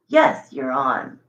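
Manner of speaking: ordinary talk, nothing marked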